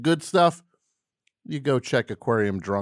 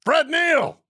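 sudden yell; 5 dB louder than usual. Real, not acted. Host randomly yelling folk people's names, sudden yell. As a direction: yell the name out, high and loud. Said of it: "Just started yelling folk people's names".

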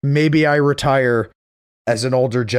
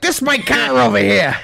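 higher, growling Boston tough guy; Boston accent; 9 semitones higher than usual. Retold or imitated. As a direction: own the parking lot, Boston style. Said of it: "great Boston accent".

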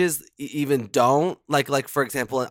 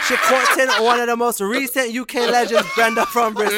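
put-on accent slipping into a sung, laughing Jamaican lilt; mock Jamaican accent; 5 semitones higher, 4 dB louder than usual. Reading the email aloud, drifting into a mock Jamaican accent. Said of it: "She's going Jamaican".

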